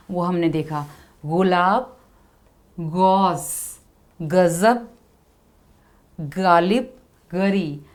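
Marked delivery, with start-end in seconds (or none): 1.2-6.9 गज़ब with a voiced gh-like ग़; teacher models ग़ in गज़ब, गौस, गालिब; nukta sounds. Shown as a demonstration. Teacher demonstrating nukta pronunciation to students. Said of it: "pronunciation किस प्रकार ग में change हुआ".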